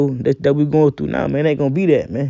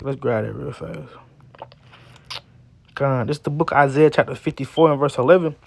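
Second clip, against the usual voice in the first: normal talking voice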